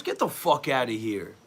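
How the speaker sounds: ordinary delivery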